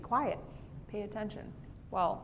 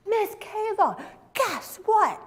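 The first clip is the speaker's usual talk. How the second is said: put-on excited child; 12 semitones higher, 6 dB louder, slower than usual. Boy bursting with news.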